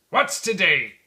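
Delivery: loud call downward. Acted. Scrooge calling from his window to a boy, loud. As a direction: shout down to the street. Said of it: "cried Scrooge".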